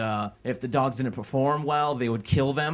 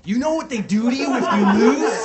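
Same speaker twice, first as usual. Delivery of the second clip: high, loud, laughing villain taunt; laughing through a hammed-up villain threat; mock movie villain; 11 semitones higher, 6 dB louder than usual. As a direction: ham up a tournament heavy, high and loud. Acted movie villain quote, laughing.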